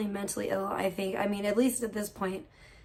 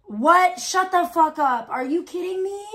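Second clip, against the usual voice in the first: shocked disbelief; 6 semitones higher, 4 dB louder than usual. Real, not acted. Streamer reading a shocking chat message, loud gasp.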